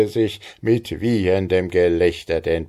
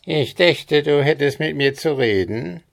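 high, reedy goblin taunt; a sly little goblin calling from behind; squeaky goblin voice; 9 semitones higher than usual. A narrator's reading. Goblin croaks at the young farmer from behind, high and squeaky. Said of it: "hörte er die Quäkstimme des Kobolds".

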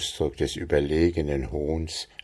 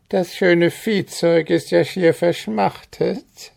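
high, sly wheedling goblin voice; cunning goblin; 12 semitones higher than usual. A narrator's reading. Goblin slyly urges the farmer to pour the water, high and wheedling. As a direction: coax him with false kindness. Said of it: "ein arglistiges Lächeln".

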